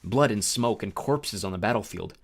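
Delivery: plain reading voice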